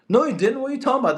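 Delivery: incredulous outburst. Real, not acted. Streamer reacts to fake donation.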